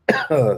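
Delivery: loud choking cough; coughing from vape. Real, not acted. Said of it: "that vape went down the wrong pipe".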